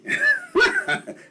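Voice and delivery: high burst of real laughter. Real, not acted. Guest laughing at his mother's warning. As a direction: laugh out loud at the memory.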